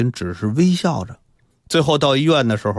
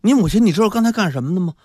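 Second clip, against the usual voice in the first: put-on high-pitched tattling kid; another child; 8 semitones higher, 3 dB louder than usual. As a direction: report it eagerly in a higher child's voice. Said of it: "另一个孩子就说".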